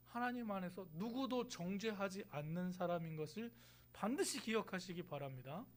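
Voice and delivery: ordinary talk, nothing marked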